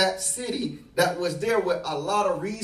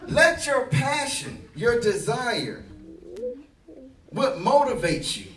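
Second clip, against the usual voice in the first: rising, louder preacher's climax; preaching at full force; 4 semitones higher, 5 dB louder than usual. Acted. Preacher rallying the congregation toward their calling, at full force. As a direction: drive it home to the congregation.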